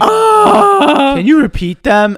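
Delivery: put-on high squeaky child asking; a tiny kid lost in the options; little kid voice.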